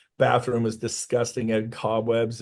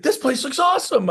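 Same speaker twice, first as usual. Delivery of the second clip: much higher and louder, bursting with praise; thrilled boss; 5 dB louder than usual. Retold or imitated. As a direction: gush like a delighted owner. Owner praising the station.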